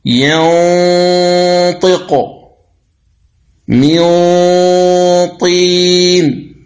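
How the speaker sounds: ينطق من طين read slowly, the نون hidden in a long nasal hum before الطاء; slow Quranic recitation of ينطق من طين with a heavy nasal ghunna; ikhfa with nasal ghunna. Shown as a demonstration. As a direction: hold the ghunna of من through the nose into طين. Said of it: "تقول".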